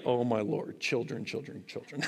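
sighing, sinking exasperation; a weary parent's mock groan; exasperated God. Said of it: "And he's like".

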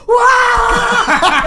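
startled scream. Retold or imitated. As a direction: scream in fright like a child. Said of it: "Eu gritava".

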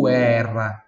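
guerra slowly stretched as "goo-EH-rra"; Italian U sounded. Shown as a demonstration. Teacher shows the difference, guerra with a drawn-out Italian U. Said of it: "Em italiano tem o som do U".

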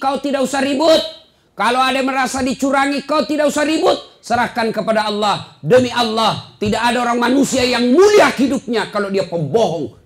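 high-pitched, emphatic sermon delivery; forceful preaching. Real, not acted. Preacher urging congregation to drop election quarrels, forceful and high.

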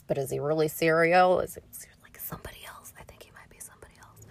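hushed, secretive whisper; conspiratorial whisper. Acted. Recapping Game of Thrones, a secret whispered to viewers. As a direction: lean in and whisper the theory. Conspiratorial aside about Serial Pharrell.

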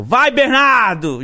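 high, slow, drawn-out mock cry; hammed-up passionate call; imitated yell. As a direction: mimic her yelling the name out. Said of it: "ela gritou".

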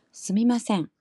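standard Tokyo sumimasen, falling; standard Japanese. Shown as a demonstration. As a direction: say 스미마센 the Tokyo way, pitch falling from 마. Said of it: "표준어 스미마센 할 때도".

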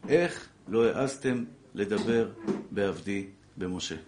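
slow, weighty divine rebuke; measured scriptural reproach with long pauses, sinking at the end; solemn verse recital. Retold or imitated. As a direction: spell out the verse phrase by phrase, slowly. Recites the verse as God's words.

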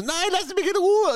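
very high, shrill mock panic; hammed-up terrified cry; panicked woman. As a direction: squeal it like a frightened woman. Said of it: "Und sie so".

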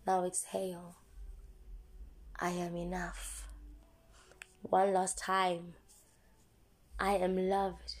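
slow, soft meditation voice; guided affirmations. Acted. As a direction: guide them calmly through affirmations. Leads affirmations and breathing.

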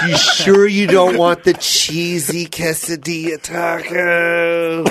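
long, drawn-out coaxing question; tempting friend. Retold or imitated. Imitating his friend tempting him with food, slow coaxing. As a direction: dangle it slowly, stretching the words. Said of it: "he goes".

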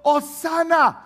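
high, loud cry; crowd shout. Retold or imitated. Pastor has the church imagine the crowd, shouting high. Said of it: "toda esta gente gritando".

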